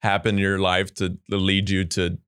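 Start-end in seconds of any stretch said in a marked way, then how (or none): none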